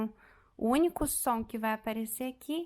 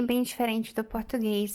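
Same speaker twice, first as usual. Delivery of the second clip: ordinary talk, nothing marked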